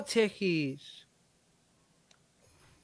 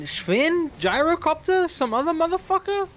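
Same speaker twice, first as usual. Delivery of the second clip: high, loud annoyed outburst; frustrated yell; 9 semitones higher, 5 dB louder than usual. Real, not acted. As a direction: yell it at the screen. Angry at bad shop roll.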